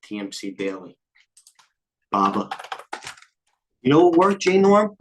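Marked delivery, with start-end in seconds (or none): 3.8-5.0 excited. Real, not acted. Excited callout to a viewer.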